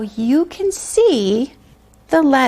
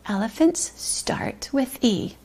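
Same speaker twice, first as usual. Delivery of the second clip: soft unvoiced whisper; hushed whispered sentence; 7 dB quieter than usual. Shown as a demonstration. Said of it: "whispered repeat after tapping".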